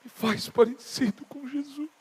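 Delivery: slow, drawn-out pleading prayer; kneeling prayer. Retold or imitated. Re-enacting his morning prayer on his knees, slow plea. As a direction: plead slowly with God. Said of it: "eu tava lá de joelho e falei".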